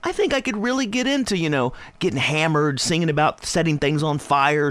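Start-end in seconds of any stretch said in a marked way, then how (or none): none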